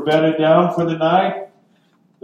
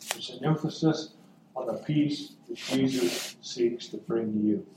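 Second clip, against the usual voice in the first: much softer, lower delivery; hushed, personal; 5 semitones lower, 11 dB quieter than usual. Real, not acted. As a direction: drop to a near-whisper for the listener. Repeats it intimately to the listeners.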